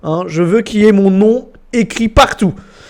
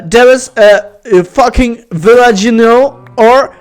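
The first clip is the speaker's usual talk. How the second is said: put-on very slow, drawn-out English with a French accent; hammed-up French-accented English; bad English accent; 2 semitones higher, 3 dB louder, slower than usual. Gamer restarting his sentence for viewers, bad English accent. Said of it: "Mon accent anglais doit être éclaté".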